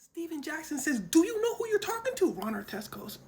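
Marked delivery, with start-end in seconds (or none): 1.0-2.3 mock indignant challenge. Heated court confrontation.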